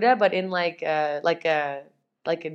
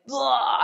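exasperated ugh; 5 semitones higher than usual. Retold or imitated. Purging, frustrated outburst at spirit.

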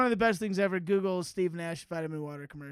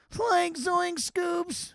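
high, slow, lightly voiced stoner; Shaggy-ish voice; 9 semitones higher, much slower than usual. Riffing on the Scooby-Doo sidekick, high and slow. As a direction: stretch it out like a goofy cartoon guy. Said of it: "what's his name from Scooby-Doo".

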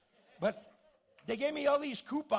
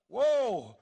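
loud, startled yelp; tripping out; 2 semitones higher, 13 dB louder than usual. Acted. Pastor acting his reaction to an angel, mock shock. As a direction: blurt it out as if an angel just appeared. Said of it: "Man, she's tripping out".

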